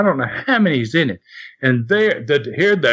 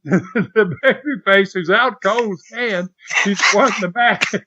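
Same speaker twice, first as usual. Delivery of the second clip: normal talking voice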